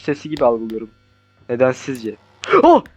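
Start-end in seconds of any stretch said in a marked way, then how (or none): 2.6-2.8 short high startled "oh"; sharp shocked cry; startled yelp. Real, not acted. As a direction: yelp at a sudden scare. Startled in horror game.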